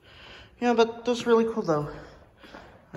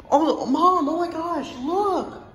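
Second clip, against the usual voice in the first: higher, thrilled call; excited; 7 semitones higher, 3 dB louder, much slower than usual. Real, not acted. Kid spots a British engine and calls Mom, thrilled. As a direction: squeal for Mom to come look.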